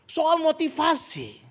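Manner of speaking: high, loud, driving sermon voice; preaching at full force. Acted. Pastor pressing the congregation on motive, preaching at full force. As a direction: hammer home the point to the congregation.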